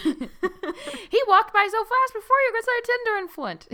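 high, loud joking voice; hammed-up, high-pitched example sentence; mock everyday usage. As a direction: toss the saying out in a silly high pitch. Joking use of the odd saying.

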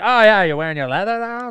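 high, loud teasing voice; mocking tease. Mocking a character's leather suit to a friend, high teasing voice. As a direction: poke fun at the leather outfit. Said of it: "It's like".

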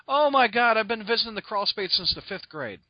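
excited fan. Retold or imitated. Costumed fan greeting the podcaster, retold excitedly. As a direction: gush like a starstruck fan. Retelling a thrilled fan's greeting.

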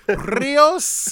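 high, questioning Spanish flourish on the name; hammed-up Spanish accent. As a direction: roll the R in mock Spanish. Jokes that Rios is Spanish.

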